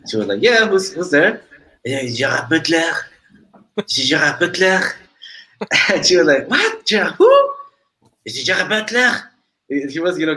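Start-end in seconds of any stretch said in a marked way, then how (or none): 0.4-1.4 imitated puzzled phone answer, higher and louder; puzzled sister. Sister answers an unknown caller. 1.8-4.8 imitated French accent on the phone; Gerard Butler. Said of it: "talking with a French accent". 6.5-7.5 imitated high, incredulous question; baffled sister. Sister fails to recognize the caller. 8.2-9.3 put-on insistent French accent, pitched up; Gerard Butler. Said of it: "talking with a French accent".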